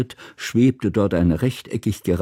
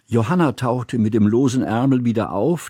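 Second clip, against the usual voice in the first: ordinary delivery, nothing marked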